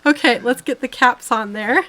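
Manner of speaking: laughing. Real, not acted. Laughing after nearly sneezing.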